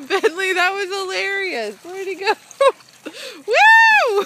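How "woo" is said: very high, loud joyful shout; real excited woo shout; loud cheering whoop. Real, not acted. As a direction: yell out in delight at the dog. Cheering dog in water.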